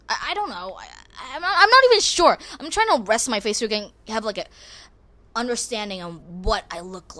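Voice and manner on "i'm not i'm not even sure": higher, louder, rushed burst; squeaky flustered rush. Real, not acted. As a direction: blurt it out flustered and fast. Flustered about her face.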